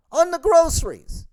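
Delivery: way up high, exasperated squeal; shrill outburst. Acted. Comic ranting at price matchers, squeaky exasperated outburst. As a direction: crack up into a high disbelieving squeal.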